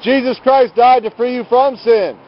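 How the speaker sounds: ordinary talk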